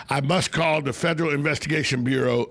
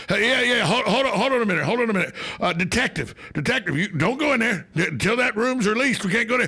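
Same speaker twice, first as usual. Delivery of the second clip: mock cop; 7 semitones higher than usual. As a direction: bark orders like a cop holding a scene. [name] plays cop to telemarketer.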